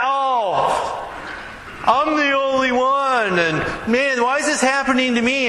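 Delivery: high, whiny why-me voice; whiny self-pity. Retold or imitated. Preacher mimicking a self-pitying churchgoer, high whine. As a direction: sulk like a martyr.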